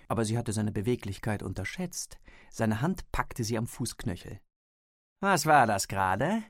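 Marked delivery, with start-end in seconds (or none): 5.2-6.4 lauter, gespielt empörte Frage; so tun, als wäre man zornig; gespielter Zorn. A narrator's reading. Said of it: "fragte er mit gespieltem Zorn". (gespielt zornig)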